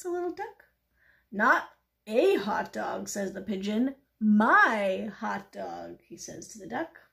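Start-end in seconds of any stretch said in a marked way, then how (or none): 1.3-3.1 slow, loud correction with a drawn-out "a"; possessive correction. Acted. Pigeon correcting the duck about its hot dog, pointed. 4.2-6.0 "My" stretched long and loud; possessive emphasis. Acted. Pigeon claiming the hot dog from the duck, possessive.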